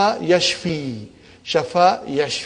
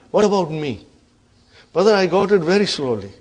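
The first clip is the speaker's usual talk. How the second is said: usual speaking voice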